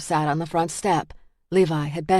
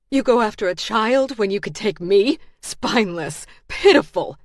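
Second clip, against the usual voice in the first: spat; 4 semitones higher, 3 dB louder than usual. Acted. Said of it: "she spat at him".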